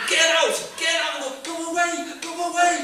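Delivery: loud, high shouted orders; shouting. Retold or imitated. Comic and woman yelling in the septic tank dream, shouting. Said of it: "There's a woman shouting".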